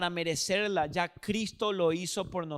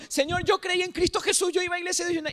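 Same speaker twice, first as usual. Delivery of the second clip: much higher, hurried, over-eager self-justifying; eager pleading believer; 9 semitones higher, faster than usual. Retold or imitated. Believer before God's throne, anxiously reciting his merits. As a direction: nervously list your good deeds to God. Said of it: "Y yo".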